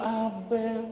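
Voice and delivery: tender Kelantan-style nagging, lightly voiced; soft Kelantan wife. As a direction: scold sweetly like a gentle Kelantanese wife. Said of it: "dia cakap lemah".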